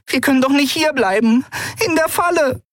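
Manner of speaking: tiny, high, frantic voice; a little winged creature in a panic; anxious fairy voice. Acted. Nelen fears trap.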